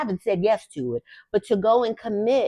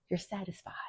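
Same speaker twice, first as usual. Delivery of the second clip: breathy contented whisper; a soft unvoiced sigh of satisfaction; 15 dB quieter, faster than usual. Real, not acted. Contented whisper after work.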